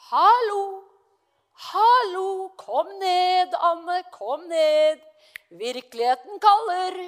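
loud, high calling out hallo. Retold or imitated. Friend calling Anne back down to earth, loud call. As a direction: call her down from the clouds, loud. Someone calls her back to reality.

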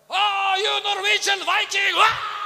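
very high, full-volume screaming outburst; furious roaring. Retold or imitated. Car rental man exploding at tourists, furious roaring. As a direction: roar it like a man exploding with rage. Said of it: "og han brølte".

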